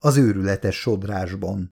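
usual speaking voice

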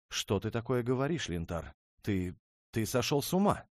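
can't believe what she hears; shocked outrage. Acted. Princess reacts to his plan to break the union, shocked.

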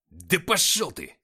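loud, slow snarling bark. A narrator's reading. Nikita barks defiance at his captor, loud angry bark. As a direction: spit it out with hatred. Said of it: "рявкнул Никита".

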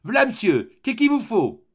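high, loud servant's voice; loud maid's answer, pitched high; maid calling from downstairs. A narrator's reading. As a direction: call back up from the depths of the basement. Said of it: "sortit des profondeurs du sous-sol".

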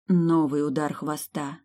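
usual speaking voice

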